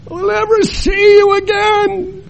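high, slow keening wail, far above his own voice; wailing grief. Retold or imitated. Mourning mother wails over the body: high slow keening. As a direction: cry out for the dead grandfather. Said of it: "weeping and wailing".

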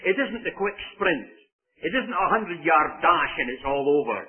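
usual speaking voice

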